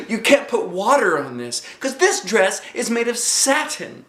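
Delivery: high prissy explaining; fussy little girl. Acted. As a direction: insist like a prim little lady. Lucille protests about satin dress.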